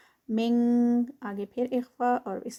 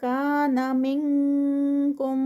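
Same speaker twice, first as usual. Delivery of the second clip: Quran recitation. Shown as a demonstration. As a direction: recite it with careful elongation. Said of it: "اخفا".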